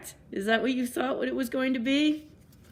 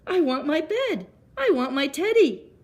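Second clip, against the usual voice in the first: put-on high, louder frightened child's plea; scared little boy; 8 semitones higher, 5 dB louder than usual. A narrator's reading. Eddie alone in the dark woods, high and frightened. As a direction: whimper it out, a boy wanting his bed. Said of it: "I'm scared already".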